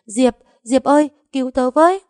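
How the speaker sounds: high, loud cry for help; a panicked woman screaming for her friend. Acted. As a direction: shriek for your friend to come save you. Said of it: "gào lên".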